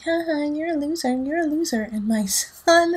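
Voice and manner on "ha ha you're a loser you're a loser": high mocking singsong taunt; taunting kid. Bully kid mocking her son as a loser. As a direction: tease like a schoolyard bully. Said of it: "being like".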